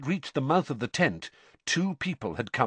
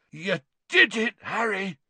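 hoarse; 8 semitones higher than usual. A narrator's reading. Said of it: "said Hagrid hoarsely".

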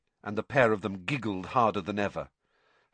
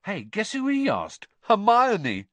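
excited; 8 semitones higher, 5 dB louder than usual. A narrator's reading. Ron gossiping, laughing.

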